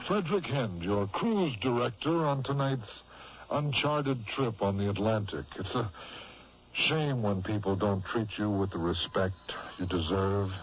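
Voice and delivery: ordinary delivery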